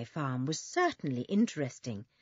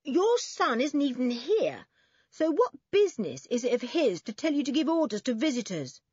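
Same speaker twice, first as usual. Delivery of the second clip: higher, forthright challenge; bold; 6 semitones higher, 4 dB louder than usual. A narrator's reading. Boy standing up to the old woman, bold. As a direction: speak up boldly, challenging her. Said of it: "Toby spoke boldly to the funny old woman".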